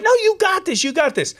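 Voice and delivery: high-pitched retold encouragement; pep talk.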